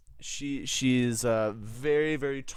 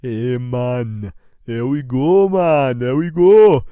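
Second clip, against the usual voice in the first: loud, lilting fake Jamaican; clumsy put-on Jamaican; bad Jamaican accent; 10 dB louder, slower than usual. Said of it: "wow, this is not a good one".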